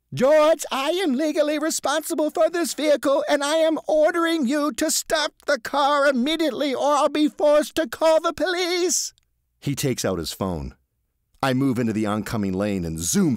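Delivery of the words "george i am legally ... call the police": high, loud threatening order; stern official warning. A narrator's reading. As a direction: lay down the law from the passenger seat. Marvin ordering George.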